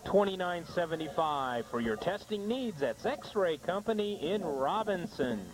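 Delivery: commercial read, phone number punched out; sponsor ad read. Acted. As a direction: sell it clearly, slowing into the final sign-off. Reads sponsor ad.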